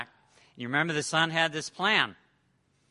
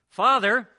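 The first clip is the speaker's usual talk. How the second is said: loud high "Father"; loud call; 7 semitones higher, 12 dB louder than usual. Acted. Son begins his planned speech.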